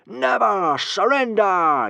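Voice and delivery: high, slow, loud war cry with never stressed; rousing yell. Acted. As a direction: bellow never surrender like a happy crowd. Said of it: "yelled the happy hooligans".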